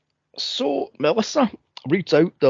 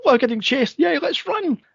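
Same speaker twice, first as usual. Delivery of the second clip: squeaky, much higher happy dog voice; excited dog voice; 11 semitones higher than usual. Retold or imitated. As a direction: voice the greyhound gleefully bolting, pitched way up.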